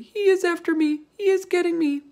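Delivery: high, loud panicked cries; terrified scream. Acted. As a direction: wail it like someone being hunted. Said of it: "screamed the flesh lump eater".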